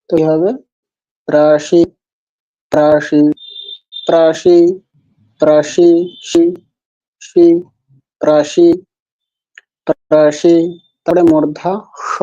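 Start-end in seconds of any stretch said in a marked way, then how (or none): 1.3-10.6 প্রাসি with a palatal sh, from the place of চ. Shown as a demonstration. Teacher drilling palatal sibilant, প্রাসি with sh on the palate. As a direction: repeat প্রাসি carefully, sh from the palate. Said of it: "সেখান থেকে উচ্চারণ করতে হবে".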